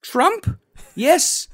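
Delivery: high, loud hammed-up English accent; slow, high Shakespearean accent; mock olde english. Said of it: "our best Shakespearean voice".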